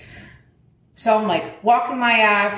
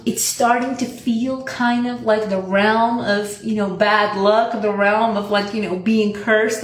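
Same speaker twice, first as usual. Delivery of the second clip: slow ominous mock-spooky; 2 semitones higher, slower than usual. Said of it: "I'm telling her".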